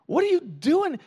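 high, loud panicked yell; alarmed shout. Retold or imitated. Sidekick yells at Sigourney Weaver, high and panicked. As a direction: yank her back with a shout. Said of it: "grabs her, pulls her back, and says".

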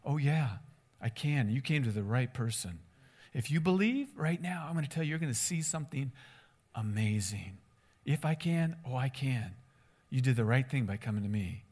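soft, low, unoffended confidence; smiling reassurance. Acted. Jesus helping this guy's faith, smiling and reassuring. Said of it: "I think he had a smile".

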